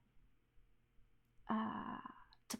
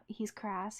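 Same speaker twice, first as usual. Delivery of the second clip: ordinary delivery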